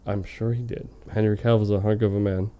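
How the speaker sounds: ordinary talk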